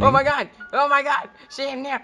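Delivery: high, loud frantic cries; screamed alarm at a jump scare; panicked shouting. Real, not acted. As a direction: shout in terror, pitch way up. Granny attacks the player.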